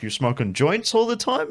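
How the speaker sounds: high-pitched mock-incredulous boss question. Restaurant owner quizzing his worker, high incredulous squeak. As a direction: squeak it up like a boss who can't believe it. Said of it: "was just like".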